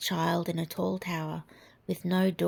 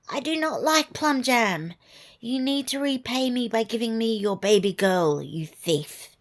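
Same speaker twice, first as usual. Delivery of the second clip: high, loud threatening witch; a witch claiming her price, loud; 4 semitones higher, 5 dB louder than usual. Acted. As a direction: witch voice demanding the baby girl. Witch demands the baby.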